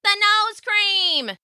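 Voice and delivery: high slow excited yell. A narrator's reading. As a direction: blurt it out triumphantly. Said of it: "another dog yelled out".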